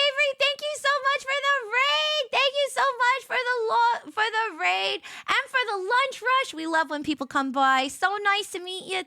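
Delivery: high, bright-eyed gushing thanks; excited thanks. Real, not acted. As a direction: gush over the raiders. Thanking viewers for a raid.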